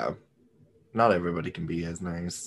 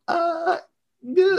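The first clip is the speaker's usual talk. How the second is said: high stumped impression; 4 dB louder than usual. Acts out being quizzed and stumped.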